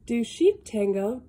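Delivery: ordinary delivery, nothing marked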